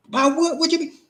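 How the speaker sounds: hammed-up furious husband, high and loud; angry husband. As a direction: play the hostile man who doesn't want to talk, loud and high. Role-play of an angry husband.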